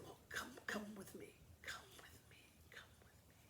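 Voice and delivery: hushed call, fading to nothing; old lady luring the girl into the woods; beckoning whisper. Acted.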